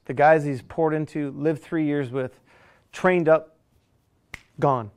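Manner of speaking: ordinary delivery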